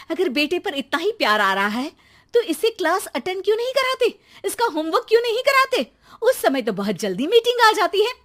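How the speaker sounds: very high, shrill rising tirade; wife scolding husband for siding with son; angry wife. Acted. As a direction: unload on the husband.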